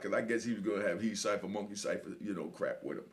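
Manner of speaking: usual speaking voice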